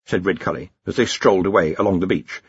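plain reading voice

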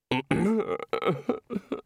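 high little throaty whimper; wordless whimper. A narrator's reading. Senior wizard overcome before the housekeeper, whimpering. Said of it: "whimpered the senior wrangler".

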